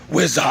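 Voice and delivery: "Wizard" sunk deep with a long-held "zaaard"; low, grandly stretched "Wizard"; drawn-out wizard. Shown as a demonstration. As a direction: drop low and hold the end of "Wizard". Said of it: "have to pronounce the game that way".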